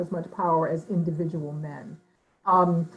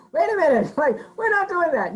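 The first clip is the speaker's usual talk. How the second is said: higher, louder mock objection; objecting council women; 8 semitones higher, 4 dB louder than usual. Council women overruling the men, raised objecting voice. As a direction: stop the men mid-decision. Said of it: "you know, like".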